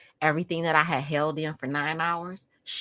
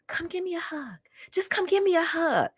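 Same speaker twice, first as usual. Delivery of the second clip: pleading mother; 11 semitones higher than usual. Retold or imitated. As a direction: imitate mom coaxing for a hug. Voices mother pleading for a hug.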